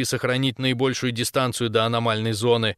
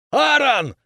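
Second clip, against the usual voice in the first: loud, high-pitched alarmed cry; shouted name; 12 semitones higher, 5 dB louder than usual. A narrator's reading. Captain calling the motionless corporal, shouting. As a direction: yell out to reach him. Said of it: "закричал я".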